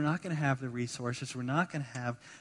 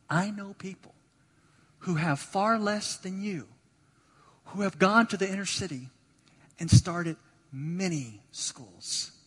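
measured, slow, emphatic reply; deliberately calm; 5 semitones higher, 3 dB louder than usual. Retold or imitated. Pastor [name] answering the lady, imitated as pointedly calm and slow. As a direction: spell it out slowly with restrained insistence. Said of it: "as calm as I could be".

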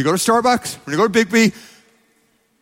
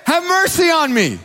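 shouting louder; 9 semitones higher than usual. Acted. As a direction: cry out even louder, desperate. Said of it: "he only shouted louder".

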